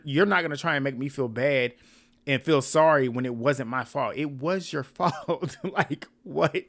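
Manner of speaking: usual speaking voice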